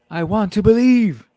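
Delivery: mock dramatic X-Files line; X-Files quote. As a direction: deliver it like a paranormal believer. X-Files catchphrase quoted playfully.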